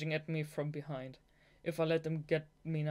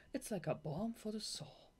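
whisper; 6 semitones higher, 5 dB quieter than usual. Acted. Reading tender line softly.

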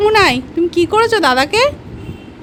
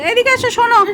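imitating a shout, higher; mild mock shout; 7 semitones higher than usual. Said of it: "চিৎকার করে".

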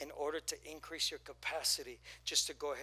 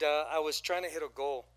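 normal talking voice

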